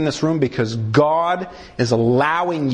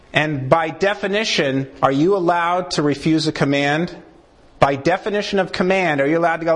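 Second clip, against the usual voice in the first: usual speaking voice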